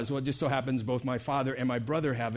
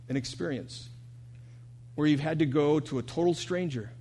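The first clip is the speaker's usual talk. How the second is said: ordinary talk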